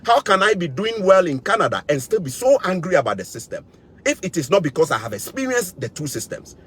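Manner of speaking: ordinary delivery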